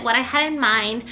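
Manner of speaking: ordinary delivery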